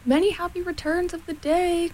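high, singing-out greeting; calling out. A narrator's reading. Pooh greeting Eeyore from across the river, calling out. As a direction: call it cheerily without real shouting. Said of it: "called out Pooh".

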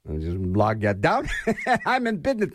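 laughing. Real, not acted. Laughing at own joke.

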